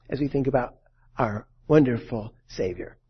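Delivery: usual speaking voice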